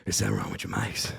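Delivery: low, quick, winded mumble of a question; mock out of breath. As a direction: imitate someone too winded to speak up. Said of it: "he was so out of breath".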